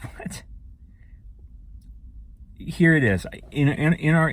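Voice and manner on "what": whisper. Retold or imitated. Baffled reaction to her answer.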